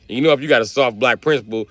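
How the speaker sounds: usual speaking voice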